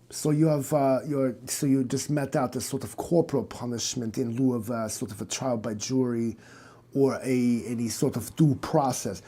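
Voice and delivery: soft, measured German doctor voice; a lightly voiced German psychiatrist; German accent. German doctor questioning the cop, in a German accent. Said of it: "Your stupid accent".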